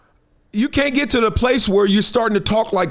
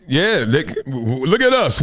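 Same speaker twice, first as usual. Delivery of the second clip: ordinary talk, nothing marked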